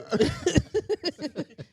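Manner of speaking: high burst of laughter. Real, not acted. Friend hears the ponytail joke, high laughter. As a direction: crack up hard.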